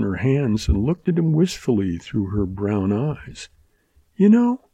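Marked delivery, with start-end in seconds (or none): 4.2-4.7 put-on lightly higher girlish voice for Mary; Mary's voice. A narrator's reading. Girl starting a question to Dan, lightly voiced. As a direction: open gently as the young woman. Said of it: "she began".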